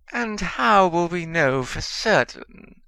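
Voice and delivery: slow, high, scornful sneer. A narrator's reading. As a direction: drawl it with open contempt. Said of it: "sneered a gaunt-faced".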